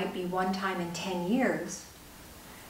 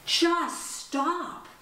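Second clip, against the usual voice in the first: breathless high shout of stop; winded mother shouting stop; yelled stop; 8 semitones higher than usual. Retold or imitated. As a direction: yell it after a hard run, out of breath. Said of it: "I just yelled".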